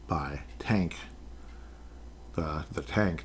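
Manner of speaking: breathy whispered aside, half-joking. Pretend. To viewers about Tank Dell, a joking whisper. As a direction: lean in and whisper it.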